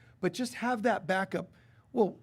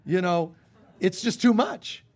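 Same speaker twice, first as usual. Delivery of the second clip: overwhelmed; 2 semitones higher, 7 dB louder than usual. Acted. Overwhelmed after outburst.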